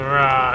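a quick playful growl, like a beast; mock monster growl. Warning of a monster coming, a mock growl.

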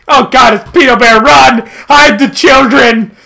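loud hammed-up alarm; mock panic shout. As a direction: yell like a panicked villager. Mock panic at Peter Bear.

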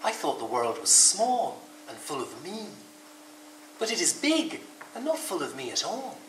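slow storybook awe, pitch swooping up; wondering. A narrator's reading. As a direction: realise it slowly, with wonder. Rabbit's wondering realisation.

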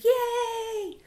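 high, long-held hooray; crowd cheer. A narrator's reading. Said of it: "the crowd cheered".

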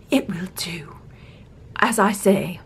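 put-on hushed aside in a royal English accent; low, barely voiced royal grudging approval; whispered queen. Queen role, dropping to whisper.

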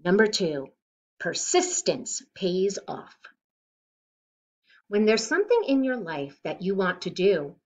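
usual speaking voice

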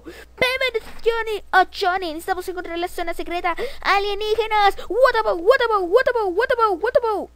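high, loud put-on game character; play-acted high-pitched alarm; frantic high voice. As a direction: squeal out an urgent alien alert. Frantic figure voice, alien alarm.

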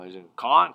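a first, gentler caw like a raven; soft raven caw. Shown as a demonstration. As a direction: try the raven's call softly. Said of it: "sorry if that was too loud".

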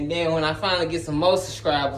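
usual speaking voice

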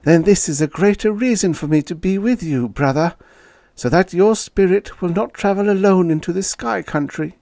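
high, insistent character voice; devoted sister, pleading. Acted. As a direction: plead to stay at your brother's side, pitched high.

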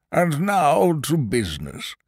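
high, slow pompous minister; fat prime minister. A narrator's reading. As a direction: call the room to business, drawn out. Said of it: "said the Prime Minister, a very fat man".

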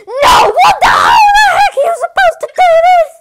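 high, loud shouting at the game; real rage at a cheap death; yelling at the screen; frustrated outburst. Real, not acted. Fails the level, outburst.

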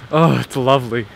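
ordinary talk

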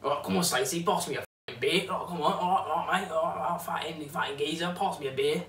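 muttered London geezer impression, firmer on the last order; Danny Dyer London accent. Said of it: "they put on this accent like Danny Dyer or Phil Mitchell".

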